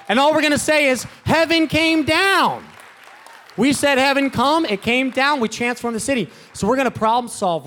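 high, loud, rising preacher's call; full-force preaching. Acted. Young pastor firing up the congregation, full-force preaching. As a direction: preach it out big to the church.

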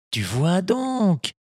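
put-on a patient old woodcarver advising his boy; kindly old Geppetto. Geppetto voiced in story.

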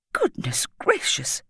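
slow, breathy whispered exclamation; surprised gasp. A narrator's reading. Headteacher noticing Patrick shivering, whispered surprise. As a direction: gasp it under your breath.

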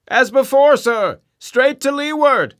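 high, loud shout from the masthead; distant cry. Acted. Lookout calls down to the captain, loud cry. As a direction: call down from far above.

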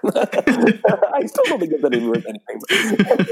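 high, slow laughing words. Real, not acted. Laughing at her own joke, slow and high. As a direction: laugh through the line.

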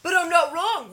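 loud, high, demanding agreement; pushy insistence. Retold or imitated. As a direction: press for a yes. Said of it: "he always goes".

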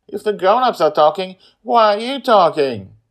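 loud, high sassy comeback; zing. Acted. Girl zinging her aunt back, loud sassy. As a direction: fire back the retort. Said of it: "Joy zings her back".

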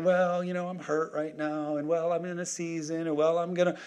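soft, plaintive excuse-making voice; mock self-pity. Preacher mimics believers saying they are hurt, mock self-pity.

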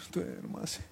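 hushed night whisper. Retold or imitated. Parent at two in the morning, whispering. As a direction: breathe it out so the baby won't wake. Sleepless night quoting spouse.

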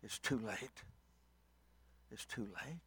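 very hushed, grave finality; soft, resigned verdict after the wailing; solemn refusal. Acted. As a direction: let it fall almost to nothing. Noah answers the children solemnly.

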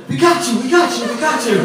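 high, gleeful crowd cries; mock excited capture. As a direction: imitate over-eager captors shouting in triumph. Acted excited crowd grabbing the teller.

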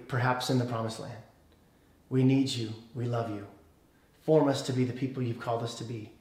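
soft, low, measured prayer with long pauses; hushed pastoral prayer, fading to amen; closing prayer. Real, not acted.

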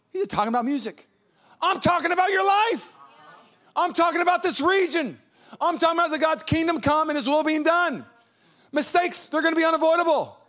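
high, loud, passionate preaching; preaching at full force. Acted. Pastor urging the congregation to cry out to God, at full preaching force. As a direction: drive it home to the congregation.

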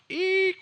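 high mock squeal of brakes. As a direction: screech to a stop like brakes, high.

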